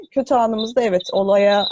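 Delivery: ordinary talk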